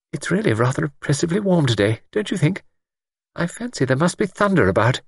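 put-on light, genteel old lady chatter; a hint of a fussy spinster; prim spinster voice. A narrator's reading. Miss Wainfleet chatting, higher voice.

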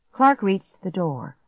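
plain reading voice

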